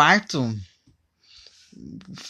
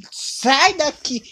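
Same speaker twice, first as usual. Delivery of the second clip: drawn-out higher angry order; 10 semitones higher, much slower than usual. Real, not acted. Yelling at the child nearby. (grito bravo e arrastado para a criança)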